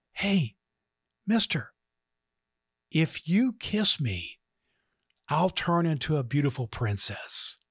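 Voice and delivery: lightly voiced lady frog, coaxing; beautiful feminine voice. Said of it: "in this beautiful feminine voice".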